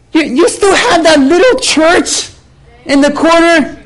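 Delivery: high, put-on belittling voice; condescending woman. Annoying woman belittles pastor's church, condescending. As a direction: imitate her with high-pitched disdain.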